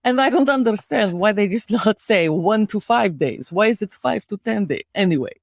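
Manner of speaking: put-on storyteller with a Russian accent. Host telling co-host about car accident, Russian accent. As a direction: tell a tale of woe in a Russian accent. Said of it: "could you do a Russian accent".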